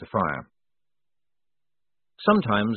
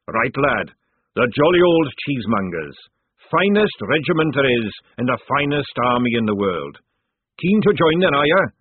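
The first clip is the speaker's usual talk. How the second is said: loud, booming fat sergeant; hearty sergeant; 4 semitones higher, 6 dB louder than usual. Acted. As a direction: boom it like a jovial recruiter.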